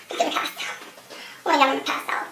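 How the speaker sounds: barely voiced, as if about to faint; breath-light dramatized near-swoon; faint whisper. Retold shock at the stain.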